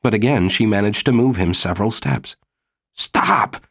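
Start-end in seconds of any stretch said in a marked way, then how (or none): 2.9-3.7 a quick, high "stop"; sharp high cry. Acted. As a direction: cut her off with a yelp. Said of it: "he said".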